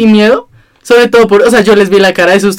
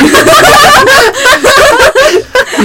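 shrieking laugh. Real, not acted. Friend shrieks with laughter at the joke, real high laugh. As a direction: crack up hard in a shriek.

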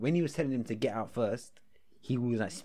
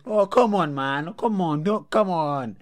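raised, louder imitated plea; pleading klaxon man; 3 semitones higher, 6 dB louder, much slower than usual. Friend acting the klaxon man begging the heckler, pleading. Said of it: "that was his accent".

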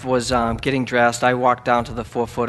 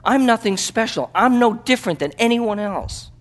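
higher, louder, ringing preacher's voice; preaching at full force; 10 semitones higher, 6 dB louder than usual. Acted. Missionary preaching to the congregation, at full force.

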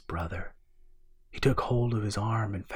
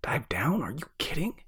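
higher, incredulous horror; horrified; 7 semitones higher than usual. A narrator's reading. Brother asked to dive down in the dark, horrified. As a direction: balk at it with swooping, disbelieving questions. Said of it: "Roland could hear the horror in his brother's voice".

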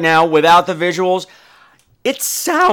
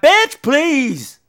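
sassy brush-off; 8 semitones higher than usual. Retold or imitated. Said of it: "And he was like".

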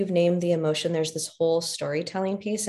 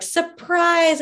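mock surprise shout; 11 semitones higher, 5 dB louder than usual. Retold crowd shouting surprise.

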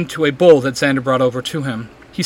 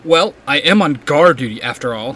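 usual speaking voice

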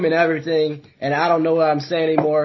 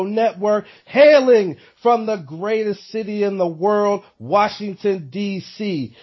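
ring announcer intro; 2 semitones higher, slower than usual. Acted. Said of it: "introduces self as champ".

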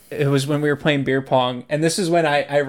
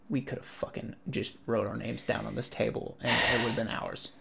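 much softer and lower, under the voice; inner thought; 6 semitones lower, 18 dB quieter than usual. Retold or imitated. Player retelling his thought at the table, hushed inner voice. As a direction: murmur it as a private thought. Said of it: "'Cause in my head".

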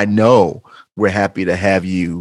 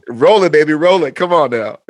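hyped up; 8 semitones higher, 4 dB louder than usual. Real, not acted. Cheering him on.

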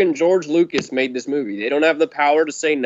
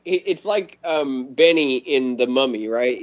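usual speaking voice

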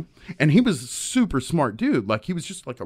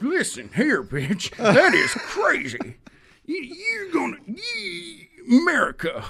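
high, strained mock rant at a higher pitch, voice cracking; irate caller; slower than usual. Outraged caller ranting at the radio host, strained and high. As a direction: play a fired-up patriot yelling into the phone. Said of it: "they're like".

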